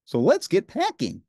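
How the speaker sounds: high, bright Muppet Ernie voice; cheerful Ernie, pitched well up. A narrator's reading. Ernie answering Bert, in a high cheerful voice.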